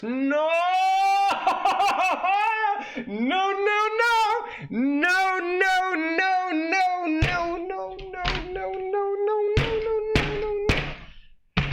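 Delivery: very high, long wail of refusal; horrified screaming no. Real, not acted. Rejecting heal orb before the fight, horrified wail. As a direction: shriek it over and over till breath runs out.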